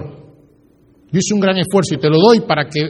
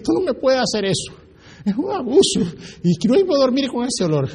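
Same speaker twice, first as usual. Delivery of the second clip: imitated tearful complaint; 7 semitones higher than usual. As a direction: plead as if starting to cry, voice raised in pitch. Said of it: "empieza a llorar y me dice". (imitando un reclamo lloroso)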